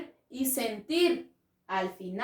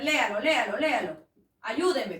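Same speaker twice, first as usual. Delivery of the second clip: usual speaking voice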